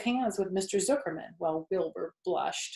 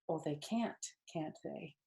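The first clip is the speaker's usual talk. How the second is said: soft murmur to herself; quietly amused, under her breath; 2 semitones lower, 12 dB quieter than usual. Acted. Charlotte murmuring to herself about the announcer, softly. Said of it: "murmured Charlotte to herself".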